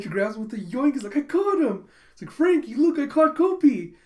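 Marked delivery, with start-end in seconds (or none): 1.2-1.9 high, loud triumphant cry; excited acted quote. Catcher shows off the cat just grabbed, excited. 2.3-3.9 loud, high-pitched excited voice; excited acted quote. Catcher tells Frankie the cat is safe, excited.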